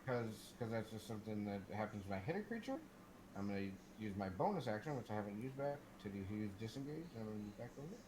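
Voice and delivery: ordinary talk, nothing marked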